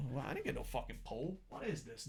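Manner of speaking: ordinary delivery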